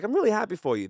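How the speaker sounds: light, offhand warmth without making much of it; casual throw-off. Retold or imitated. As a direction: toss the praise off lightly. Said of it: "a little throw off of".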